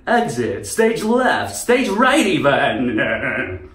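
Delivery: theatrical cartoon cat, drawn-out ending; Snagglepuss. Cartoon cat announcing his exit, theatrical impression. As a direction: ham up a cartoon exit line, loud. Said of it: "Snugglepuss, he's fucking funny".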